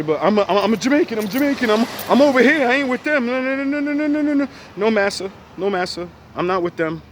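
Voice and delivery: high, loud frantic pleading, voice climbing; shrill begging to be left alone; panicked denial. Retold or imitated. Mocking panicked Caribbean immigrant.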